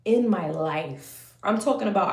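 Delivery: ordinary delivery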